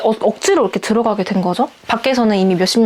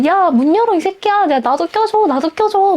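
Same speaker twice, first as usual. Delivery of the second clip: retold yelling from outside; 9 semitones higher than usual. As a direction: call out like jeering boys behind a locked door. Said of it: "막 이런 소리가 막 들렸었어요". (문 밖에서 외치는 높고 큰 조롱조 고함 흉내)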